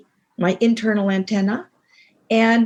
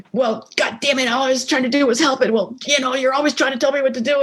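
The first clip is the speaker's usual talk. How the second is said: higher, faster mock argument; imitating a couple snapping at each other; mock angry quarrel; 5 semitones higher, faster than usual. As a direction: act out a heated spat, hammed-up. Imagined escalating marital quarrel.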